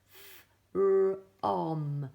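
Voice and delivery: loud sounding out of f-r-o-m; each letter's sound, punched. Shown as a demonstration. Teacher modelling sounds for the class. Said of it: "We always say the sound".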